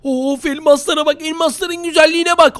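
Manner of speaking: high-pitched gushing awe; excited admiration. Real, not acted. Player gushing over a field of diamonds, excited admiration. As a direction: marvel at it, voice lifted.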